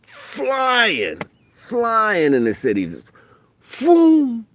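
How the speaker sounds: high, loud, drawn-out rush; excited reliving. Retold or imitated. Remembering racing on the highway, excited. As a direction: ride the memory to the boom.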